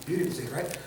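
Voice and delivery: usual speaking voice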